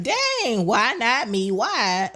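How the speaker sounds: high-pitched mock "why me" protest; mock dismay. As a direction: play up the wounded disbelief. Acted reaction to a setback.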